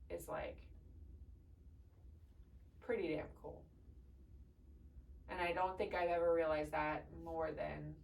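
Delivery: real choked-up, halting delivery with long pauses; soft, low words through held-back tears; tearful. Real, not acted. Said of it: "Sorry that I cried so much".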